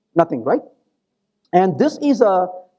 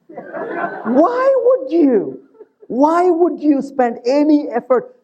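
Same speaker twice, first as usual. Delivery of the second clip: slow question laughed through, pitched up; laughing; 5 semitones higher, much slower than usual. Real, not acted. Lecturer laughing at his own joke to students, slow laughing question. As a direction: let the laugh carry the words out slowly.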